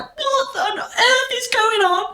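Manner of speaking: high, slow, half-laughing panic; panicked disbelief. Retold or imitated. Retelling her first walk onto the show, mock panicked squeal. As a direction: squeak it out like you are overwhelmed.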